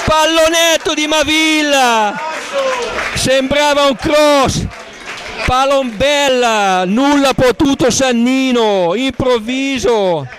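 high, shouted goal call, fading down; breathless italian goal commentary. Real, not acted. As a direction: scream the goal, then ride it out. Commentator calls second goal live. (urlo del gol concitato, poi sfumando)

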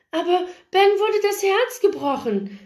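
put-on high-pitched girlish protest; pleading girl. A narrator's reading. Paige explains her brother's heartbreak, high pleading voice.

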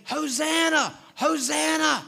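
high ringing shout of Hosanna; crowd praise shout. Retold or imitated. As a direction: call it out like the welcoming crowd. Crowd praising Jesus acted out.

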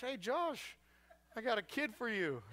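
high, breezy call; mock hand-off. Parent handing a kid to Josh, high and breezy. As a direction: toss it off like a favour. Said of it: "You're going up to Josh".

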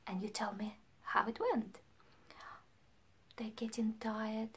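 hushed whisper; softly, they're getting sleepy. Acted. Teacher whispering as the teddies get tired.

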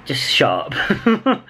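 slow, deliberate shut up; slow emphatic. Shown as a demonstration. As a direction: drag out the words for emphasis. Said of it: "feeling cranky".

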